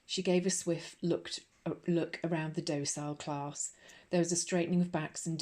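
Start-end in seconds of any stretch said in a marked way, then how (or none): none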